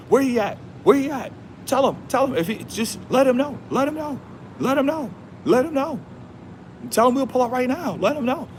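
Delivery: very high-pitched, hyped-up calling out; fired-up challenge. Retold or imitated. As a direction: call him out in a high, repetitive holler. Re-enacts fired-up challenge before fifty K.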